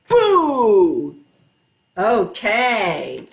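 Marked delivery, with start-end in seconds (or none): 0.1-1.1 loud mock scare for the kids; teacher startles the children, louder; playful boo. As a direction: jump out with a big boo. Surprise ending of chant game.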